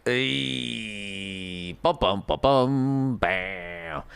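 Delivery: low hummed dun-dun sound effect; mock dramatic sting. Ominous sting after the stomach detail. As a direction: hum a horror-movie sting.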